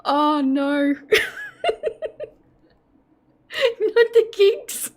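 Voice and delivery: high, squeaky words between bursts of laughter; real fit of giggles; helpless giggles. Real, not acted. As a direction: wail it weakly as laughter takes over. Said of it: "I'm gonna get the giggles now".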